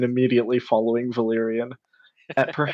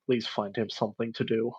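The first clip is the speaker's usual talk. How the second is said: barely voiced, much softer; almost silent plea; mouthed plea; 9 dB quieter than usual. Ito silently pleads with the leader for a task, hushed. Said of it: "is just mouthing".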